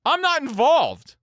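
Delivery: loud, high, stretched-out plea; hammed-up cry of innocence; exasperated protest. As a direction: protest slowly at full pitch. Acted protest at being dragged in.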